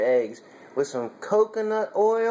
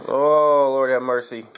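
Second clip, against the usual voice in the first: exasperated oh; 4 semitones lower, 6 dB louder than usual. Real, not acted. Exasperated at the mess.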